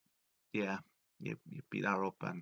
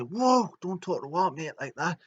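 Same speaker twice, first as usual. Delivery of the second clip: higher, mock-outraged bloke voice; lightly voiced angry friends; indignant mates; 7 semitones higher, 4 dB louder than usual. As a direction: stick up for your mate. Friends defending their mate.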